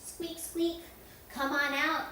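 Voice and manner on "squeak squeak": "squeak, squeak" said higher like a tiny mouse; high playful mouse squeak. Pretend. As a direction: squeak like a little mouse to wake the kids. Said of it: "All right, little mice".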